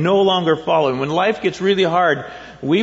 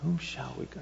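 much softer, lower "To whom shall we go?"; inner thought; 4 semitones lower, 17 dB quieter than usual. Pretend. Congregant pondering on the way out, hushed inner voice. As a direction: murmur it as a private thought. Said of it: "you would be thinking".

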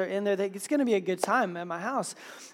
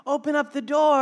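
raised-pitch imitation of dad calling; higher, insistent acted dad voice; urgent dad; 6 semitones higher, 4 dB louder than usual. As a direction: call through a door as a worried father. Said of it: "he's like, he's like".

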